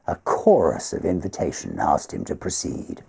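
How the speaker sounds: ordinary delivery, nothing marked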